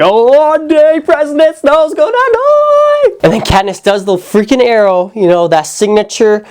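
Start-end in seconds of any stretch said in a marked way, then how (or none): 0.0-3.1 much higher, slow, sing-song glee; slow, much higher gloating sing-song; gleeful anticipation. Retold or imitated. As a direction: savour it like a kid who can't wait. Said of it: "I was like".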